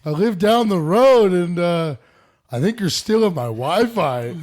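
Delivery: hammed-up old neighbour; old man voice. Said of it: "the guy's like".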